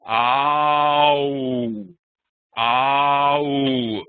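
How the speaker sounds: "Ow" drawn out from an open mouth to pushed-out lips; ow demo. Shown as a demonstration. Teacher modelling "Ow" twice for the class to repeat. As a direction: stretch "Ow" so the mouth shape can be seen. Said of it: "Remember mouth position".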